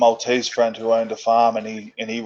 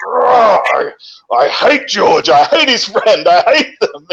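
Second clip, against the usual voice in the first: higher, louder, laughing through the mock rant; mock angry rant; 8 semitones higher, 5 dB louder than usual. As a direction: act out old anger while laughing at it. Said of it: "I used to get so angry".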